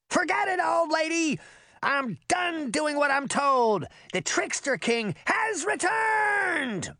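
very high, grandiose proclamation; defiant shout. A narrator's reading. Trickster boy defies the old lady, shouting. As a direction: crow it high, stretching out the final boast. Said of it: "he shouted".

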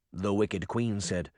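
ordinary delivery

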